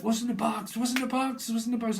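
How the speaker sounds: a hint of panic; mock frantic question. A voice asking about the box, mock frantic.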